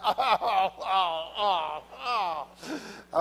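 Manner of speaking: mock groans of dismay, one after another; imitated groaning. Preacher mimicking drivers at the gas pump, groaning. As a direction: act out a string of pained "oh"s. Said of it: "had to fill up your vehicle and went".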